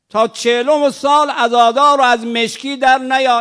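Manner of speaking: loud, high cry of a grieving man; preacher mimicking a mourner's loud lament; mock wailing mourner. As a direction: ham up the mourner shouting at God. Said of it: "بنشینم فریاد بزنم".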